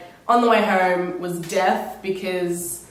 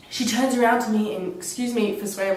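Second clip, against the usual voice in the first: ordinary delivery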